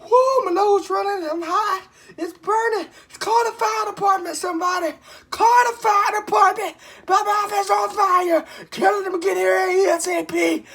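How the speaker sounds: high, loud panicked yelling; panicked shouting. Real, not acted. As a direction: yell in real panic at the burn. Mouth burning, yelling for help.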